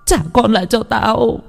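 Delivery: put-on high shrill demanding scream for her child; mother screaming for her stolen child; frantic screaming. A narrator's reading. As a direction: scream at the beast to give back your son. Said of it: "Bà Hoa gào lên".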